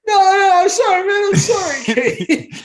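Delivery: very high, loud, laughing plea; begging shout. Retold or imitated. Jimmy begging his friend to stop, high, loud and laughing. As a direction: beg for mercy while cracking up. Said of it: "He's like, I just went".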